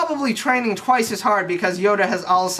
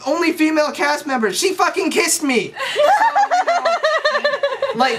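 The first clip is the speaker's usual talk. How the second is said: laughing aside; 12 semitones higher, 3 dB louder than usual. Real, not acted.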